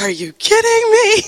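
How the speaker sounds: high, choked-up are you kidding me; overwhelmed disbelief. Retold or imitated. Retelling God's answer, overwhelmed and tearful. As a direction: cry it out in stunned disbelief. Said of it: "I just started bawling".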